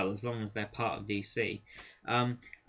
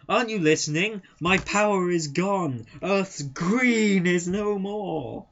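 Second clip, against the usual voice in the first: hammed-up despairing hero; 6 semitones higher, 6 dB louder than usual. Green Lantern mourning his lost powers, hammed-up wail. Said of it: "there's quite a lot".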